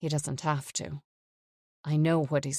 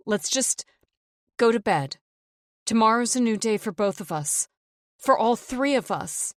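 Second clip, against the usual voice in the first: anxious, higher plea to calm him; higher pleading voice; 6 semitones higher, 4 dB louder than usual. Acted. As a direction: soothe him, nervously begging. Wife pleads with raging husband.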